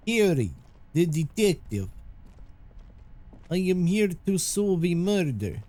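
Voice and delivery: a streamer's bad mock Russian accent; terrible Russian accent. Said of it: "I'm sorry for my terrible Russian accent".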